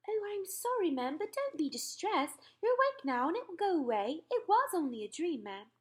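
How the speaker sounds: calm the queen as her maid; a maid reassuring her mistress; maid's soothing voice. Acted.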